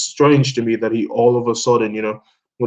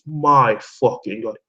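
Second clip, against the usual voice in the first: ordinary talk, nothing marked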